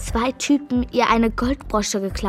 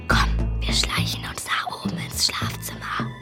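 whispering; 14 semitones lower, 5 dB quieter than usual. Acted. Sneaking upstairs, hiding.

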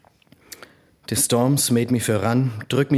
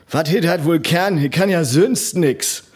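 mocking; 6 semitones higher, 3 dB louder than usual. Other boys mocking him.

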